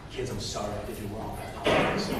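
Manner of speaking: very soft, low apology; quiet apology. Retold or imitated. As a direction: humbly say sorry to your kids, hushed. Acts out apology to his kids.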